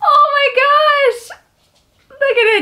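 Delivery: high, loud, drawn out and near tears; overwhelmed, almost crying with joy; slow teary shout of joy; tearful squeal. Real, not acted. Overwhelmed by gift.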